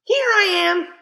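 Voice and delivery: put-on high, loud cheerful child voice; little sister voice. A narrator's reading. Little sister calling down from the tree, high and cheerful. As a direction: pop up brightly, high as a small girl. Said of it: "a voice said".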